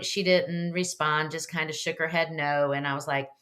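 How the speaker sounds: usual speaking voice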